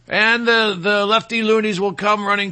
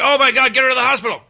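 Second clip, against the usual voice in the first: mock alarmed crowd; 5 semitones higher, 4 dB louder, much faster than usual. Bar crowd reacting to fake suicide.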